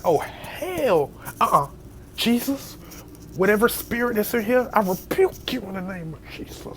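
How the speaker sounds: high preacher-style rebuke; mock rebuking prayer. Scared of his staring cat, rebuking the spirit like a preacher.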